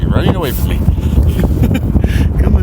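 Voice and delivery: high coaxing voice to a crab. Real, not acted. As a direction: sweet-talk a little critter.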